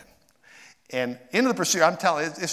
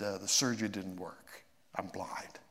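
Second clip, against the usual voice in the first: quiet despair; 4 semitones lower, 9 dB quieter than usual. Retold or imitated. Retelling the phone call for help.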